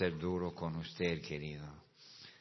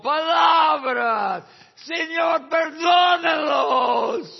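much higher, slow, shouted with a crack of crying; slow, high, sobbing shout to the Lord; tearful preaching shout; 14 semitones higher, 11 dB louder, much slower than usual. Acted. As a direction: wail it like you're watching him die. Preacher relives Stephen being killed.

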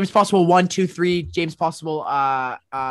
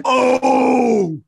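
long, high, loud "oh"; loud realisation; 7 semitones higher, 7 dB louder than usual. Pretend. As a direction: shout it out as the penny drops, drawn out. Exaggerated sudden realisation on comedy show.

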